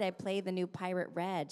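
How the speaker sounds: ordinary talk, nothing marked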